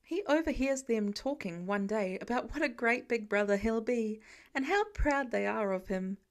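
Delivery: ordinary delivery, nothing marked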